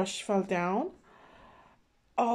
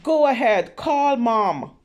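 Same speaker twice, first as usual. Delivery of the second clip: loud taunting dare; defiant shout; 10 dB louder than usual. Acted. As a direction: challenge him to tell Mom, loudly.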